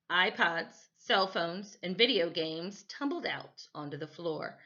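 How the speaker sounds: ordinary delivery, nothing marked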